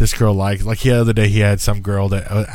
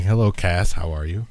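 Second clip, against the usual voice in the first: normal talking voice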